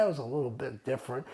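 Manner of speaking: ordinary delivery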